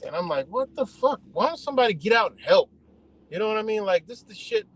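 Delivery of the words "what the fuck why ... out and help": high-pitched indignant demand; retold exasperation. Bystander calling out for help at a crash, exasperated. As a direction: replay your outrage, pitched right up. Retells exasperation at passing drivers.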